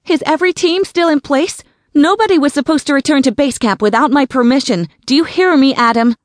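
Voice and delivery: high, loud, rapid demand; commanding radio call. A narrator's reading. Sarah demanding answers from Adam over the walkie-talkie. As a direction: bark orders into the radio.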